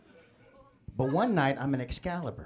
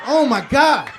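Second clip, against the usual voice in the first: loud exclaim; 8 semitones higher, 11 dB louder, slower than usual. Acted. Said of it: "exclaims in the bit".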